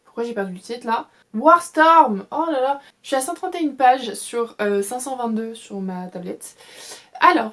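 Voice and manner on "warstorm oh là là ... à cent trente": blurts the title out, louder and higher; a triumphant "found it!" on the word; excited exclamation. Real, not acted. Booktuber finally recalling the book's title, exclaimed loudly.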